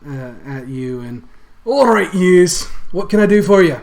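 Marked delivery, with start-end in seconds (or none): 1.6-3.8 put-on Scottish accent. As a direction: boom it out in a thick Scottish brogue. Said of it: "I don't know if I can carry on the accent".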